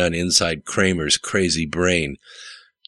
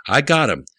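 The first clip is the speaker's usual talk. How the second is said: higher, mildly raised triumphant call; yelled out; 8 semitones higher than usual. A narrator's reading. Kyle announces success at his keyboard, a mild narrated yell. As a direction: call it out high, without a full shout. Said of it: "he yelled out".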